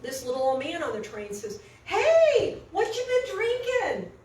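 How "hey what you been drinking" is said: put-on high, loud, cheery old-man call; all friendly. Little old man calling to a drunk on the train, friendly. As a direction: hail a stranger with big friendly interest, slowing down. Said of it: "he's all friendly and everything".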